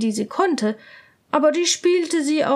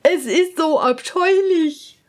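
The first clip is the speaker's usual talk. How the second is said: much higher, coy court lady; hesitate, too ashamed to say it; embarrassed lady-in-waiting; 4 semitones higher, 4 dB louder than usual. Acted. Lady-in-waiting shies from the answer, high and coy. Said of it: "antwortete die Hofdame".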